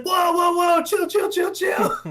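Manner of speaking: high, loud panicked mimicry; panicked protest. Airstriked player yelling at his attacker, frantic high protest.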